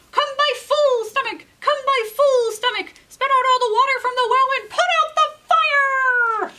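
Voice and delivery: high, loud rooster chant; rooster calling out. Acted. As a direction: summon the magic stomach like a spell. Rooster calls on his stomach.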